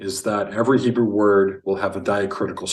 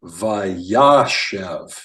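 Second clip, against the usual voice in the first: Vayashev with the middle syllable YA punched louder; correct Hebrew stress; 5 dB louder than usual. Shown as a demonstration. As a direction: lean on YA in Vayashev, then drop off. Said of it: "first word is pronounced".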